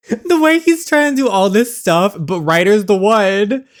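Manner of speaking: high and loud, gleeful disbelief; excited squeal. Real, not acted. Gamer thrilled the dad picks the boss, squealing high and loud.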